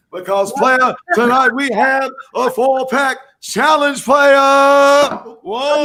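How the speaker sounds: hyped host. Pretend. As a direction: pump up the crowd.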